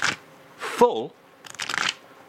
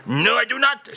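indignant retort; 10 semitones higher, 4 dB louder than usual. Acted. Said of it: "has to have the last word".

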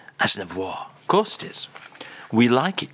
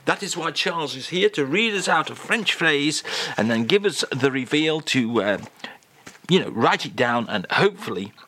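usual speaking voice

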